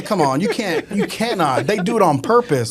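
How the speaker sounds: normal talking voice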